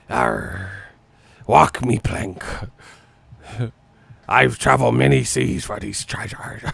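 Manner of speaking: lightly voiced pirate growl; mock pirate. Laughing through a mock pirate bit for viewers. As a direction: play a pirate half-hearted. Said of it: "sound like a pirate".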